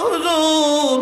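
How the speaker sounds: put-on high, sung wail of an orphan boy; wailing child. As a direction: cry out like a grieving child, half singing. Preacher voices weeping orphan boy. (উঁচু সুরে কাঁদো কাঁদো শিশুর কণ্ঠ)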